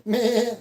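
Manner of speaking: put-on high, bright meh for a toddler; goat bleat. Shown as a demonstration. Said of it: "What does the goat say?".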